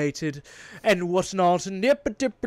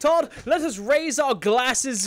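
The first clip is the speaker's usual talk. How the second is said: slow, higher, louder hammed-up toast; mock genteel toast; 7 semitones higher, 5 dB louder, slower than usual. Podcaster mocking pretentious dialogue with a toast, mock genteel. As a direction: lightly play a pompous tea-party host.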